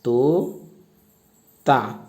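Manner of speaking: very slow, stretched answer word; drawn-out answer. Shown as a demonstration. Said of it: "क्या लिखाएगा". (शब्द को खींचकर बोलना)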